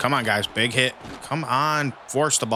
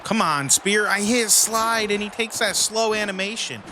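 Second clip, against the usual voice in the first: frustrated yelling; 11 semitones higher than usual. Real, not acted. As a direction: cry out in disbelief at the turnover. Fumble rant at controls.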